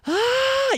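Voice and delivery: short high mock yell of panic; a quick squealed ah; hammed-up frustrated shriek; mock scream. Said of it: "you're gonna wanna scream".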